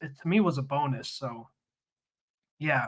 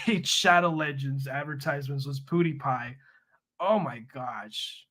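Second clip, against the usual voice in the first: ordinary delivery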